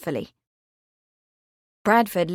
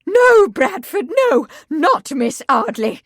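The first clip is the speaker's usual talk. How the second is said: much higher, louder, slow pleading; mother pleading with her son; horrified protest; 7 semitones higher, 7 dB louder than usual. Acted. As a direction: beg him in horror.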